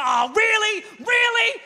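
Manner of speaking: high, mock-furious disbelief; exasperated outburst. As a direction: yell it at a crashed computer. Mimics rage at crashed computer.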